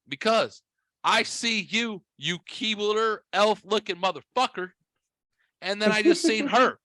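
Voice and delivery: high-pitched mock outrage; incredulous rant. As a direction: tease a buddy with exaggerated disbelief. Retold mock rant at friend.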